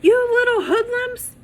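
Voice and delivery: very high, loud furious screech; shrieking angry jogger. Acted. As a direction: scream it at the kids. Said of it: "A screech came".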